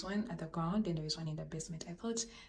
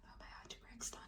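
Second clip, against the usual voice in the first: heartbroken whisper; 16 dB quieter than usual. Real, not acted. To viewers after the search fails, a crushed whisper.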